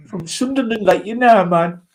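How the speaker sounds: put-on teasing Sunderland accent with a laugh; Mackem accent. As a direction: lay on a Mackem "man" to tease. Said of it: "called me a Mackem".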